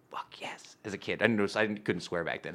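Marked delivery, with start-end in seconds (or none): 0.1-0.8 breathy hushed fuck yes; hushed excited whisper; whispered kid excitement. Retold or imitated. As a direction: whisper it like a secret thrill. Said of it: "And I was like".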